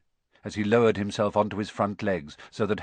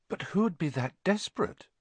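put-on higher boy's voice, wondering; wondering aloud; 7 semitones higher, 3 dB quieter than usual. A narrator's reading. Boy puzzling over the centaur's warning, wondering aloud. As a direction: muse in a lightly raised boy's pitch. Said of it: "he wondered aloud".

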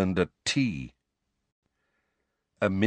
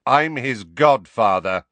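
louder, slow and emphatic; louder than ever; 2 semitones higher, 7 dB louder than usual. A narrator's reading. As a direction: insist on his right to stay. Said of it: "louder than ever".

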